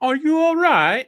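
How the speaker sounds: high, slow attempt at British; bad English accent. Host who can't do a UK accent, bad English accent. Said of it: "I can't do it".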